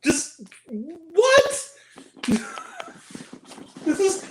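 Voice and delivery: high, loud incredulous "what"; real stunned reaction breaking into laughter; disbelief. Real, not acted. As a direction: gasp of baffled disbelief into a laugh. Baffled reaction to the film.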